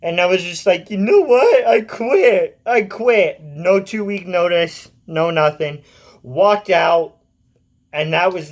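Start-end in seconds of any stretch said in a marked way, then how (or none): 0.9-3.4 quitting outburst. Retold or imitated. Storms out on yelling boss.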